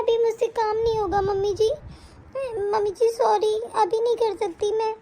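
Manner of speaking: normal talking voice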